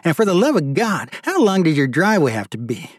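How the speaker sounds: exasperated rant. Acted. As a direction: whine about the long wet walk.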